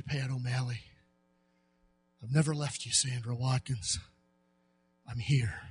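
God quietly telling Elijah and the members he's never left them; tender voice of God. Acted. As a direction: hush right down and comfort him.

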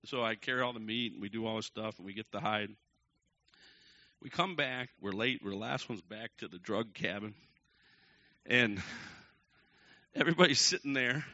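ordinary talk

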